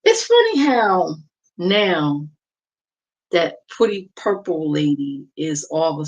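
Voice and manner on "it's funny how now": ordinary talk, nothing marked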